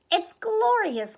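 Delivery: high, drawn-out singsong; singsong delight. A narrator's reading. Goldie admires the redecorated bedroom, singsong delight. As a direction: sing it out with delight. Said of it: "Goldie sang".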